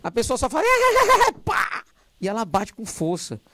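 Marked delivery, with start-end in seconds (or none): 0.7-1.4 sudden high burst of laughter; big laugh after the joke; loud laugh. Real, not acted.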